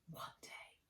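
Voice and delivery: breathy unvoiced whisper, to herself. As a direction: murmur the promise under her breath.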